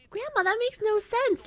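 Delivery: high, loud mock indignation; acted squeal of disbelief; exasperated protest. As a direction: object shrilly to grandma's logic. Acted argument with grandma.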